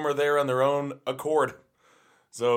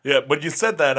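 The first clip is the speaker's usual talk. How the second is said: ordinary delivery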